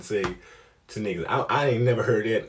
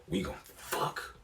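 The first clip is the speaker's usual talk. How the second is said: imitating her low whisper; hushed mock seduction; secretive come-on; 3 semitones lower, 12 dB quieter than usual.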